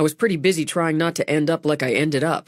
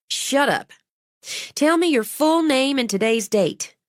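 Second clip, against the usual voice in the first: high-pitched, no-nonsense woman; bossy medic; 10 semitones higher than usual. A narrator's reading. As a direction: cut the patient off and take charge. Medic cuts off protesting patient.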